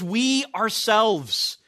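slow, stress on "We", "ourselves" drawn out; emphatic. Shown as a demonstration. Preacher stressing the verse to the congregation, emphatic and slow. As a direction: lean hard on "We" and let "ourselves" land. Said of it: "the way that he expresses this is very emphatic".

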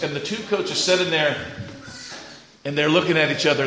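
ordinary delivery

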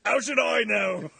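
slow put-on English accent. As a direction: say it slowly in your one English accent. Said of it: "I only know one English accent".

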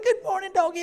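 put-on high, sing-song baby talk to a dog; sweet cooing voice. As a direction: coo at the dog like a pet.

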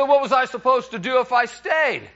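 high, loud, frustrated husband; comic outburst of exasperation; acted exasperated protest. Retold husband protesting in quarrel.

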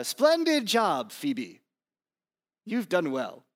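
mock posh British praise; a quick, playful British accent; half-hearted British accent. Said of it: "I don't know why it goes British".

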